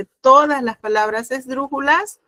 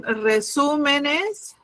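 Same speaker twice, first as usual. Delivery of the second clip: slow dictation; 3 semitones higher, 4 dB quieter, much slower than usual. Shown as a demonstration. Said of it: "teacher dictates word slowly".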